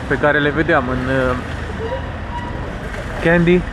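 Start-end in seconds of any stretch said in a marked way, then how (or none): none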